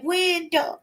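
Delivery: high, startled wail of the name; a hammed-up dismayed yelp; mock dismayed cry. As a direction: act out the panic when it broke. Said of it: "I was just fixing to say".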